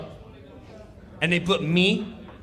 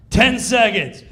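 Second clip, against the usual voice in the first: countdown call; 5 semitones higher, 10 dB louder than usual. Retold or imitated.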